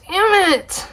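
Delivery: Damn it squeaked high, falling; mock whine. Real, not acted. Repeats in frustration.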